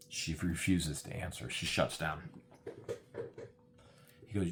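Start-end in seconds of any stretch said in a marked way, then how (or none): none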